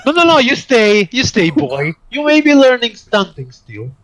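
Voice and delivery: loud, high-handed showman's command; commanding Harris figure. Acted. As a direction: call the boy back imperiously. Harris orders the agent to stay.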